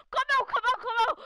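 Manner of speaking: loud playful call, sing-song teasing. Real, not acted. Player calling his friend out of hiding, loud teasing shout. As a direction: shout it out like hide and seek.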